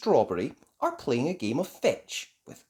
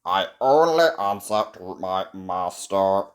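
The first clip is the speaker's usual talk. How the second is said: toy robot; 5 semitones lower, 5 dB louder than usual. A narrator's reading. Robot waking to meet Ben, low robotic voice. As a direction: speak evenly like a machine.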